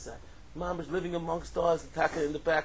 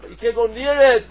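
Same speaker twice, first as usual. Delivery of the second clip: emphatic louder repeat; 7 semitones higher, 17 dB louder than usual. Acted.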